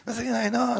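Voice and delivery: cheerful Osaka mother teasing gently; mother in Osaka dialect. Retold or imitated. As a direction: tease him like an Osaka mom who's cheerful about it. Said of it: "大阪弁でね".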